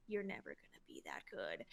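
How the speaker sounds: low, soft voice of self-doubt; little inner voice. Acted. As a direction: drop it low and quiet, a nagging inner critic. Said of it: "that little voice in the back of my brain is like".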